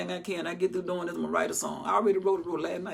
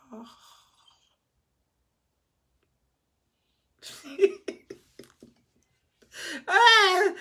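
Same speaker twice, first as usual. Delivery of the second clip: high laugh; 11 semitones higher, 3 dB louder than usual. Real, not acted.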